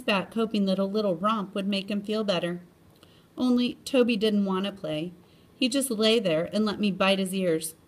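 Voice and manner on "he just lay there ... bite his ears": ordinary delivery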